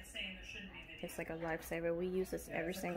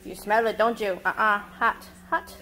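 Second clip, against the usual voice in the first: loud warning; 2 semitones higher, 10 dB louder than usual. Real, not acted. Warning a child about hot food.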